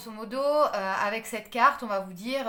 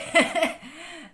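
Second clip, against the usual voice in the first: a quick burst of laughter, louder and higher; short laugh; 6 semitones higher, 8 dB louder than usual. Real, not acted. Reader laughing to viewers at the card. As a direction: laugh at the odd card picture.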